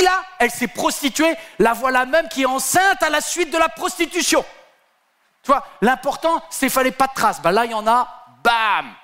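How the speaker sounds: rising excitement. Acted. Preacher telling the crowd Judas is caught, rising excitement. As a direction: build it up to the bam.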